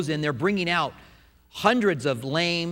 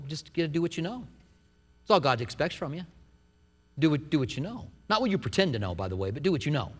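usual speaking voice

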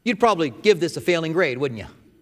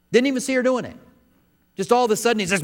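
higher and louder, building excitement; excited storytelling; 7 semitones higher, 5 dB louder than usual. A narrator's reading. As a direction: tell the climax with rising energy.

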